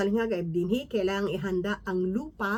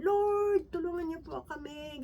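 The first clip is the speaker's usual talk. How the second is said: imitating a plea; 7 semitones higher, slower than usual. Desperate people running to the Lord, pleading. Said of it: "tumatakbo na kay Lord".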